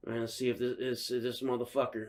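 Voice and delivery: soft, low-pitched suspicion; low self-talk. Retold or imitated. Driver watching the van across the street, low muttering.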